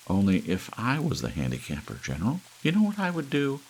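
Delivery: high, fast, eager wife; high chattering Hazel; Hazel's voice. A narrator's reading. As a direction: rush the question out excitedly.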